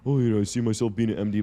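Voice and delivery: quick, smug, rehearsed answer; mock candidate voice. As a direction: rattle off a canned line, mocking. Mocking a job candidate's answer.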